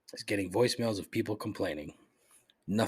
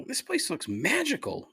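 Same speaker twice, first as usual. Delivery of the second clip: much higher dreamy gush; gushing delight; 13 semitones higher than usual. Real, not acted. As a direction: swoon over the menu. Delight at dessert menu.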